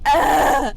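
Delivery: loud, high yell of "ah"; frustrated scream. Real, not acted. Player who just died, frustrated scream. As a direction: let out a full frustrated scream. Screams after dying in game.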